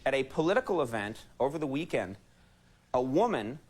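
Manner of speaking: ordinary talk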